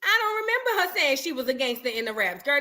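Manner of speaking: usual speaking voice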